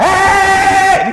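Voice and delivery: very high, loud, drawn-out yell; hammed-up cry of a startled player; mock shout. Fan acting out Philip when clamped, mock shout.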